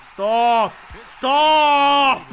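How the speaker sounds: loud, drawn-out shout; real bellowed command; desperate yelling. Real, not acted. Yells at play to stop.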